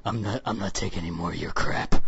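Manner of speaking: lightly imitated low gravel of a game hero; Adam Jensen voice. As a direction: drop way down into a tough-guy murmur. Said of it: "He's got that kinda low thing".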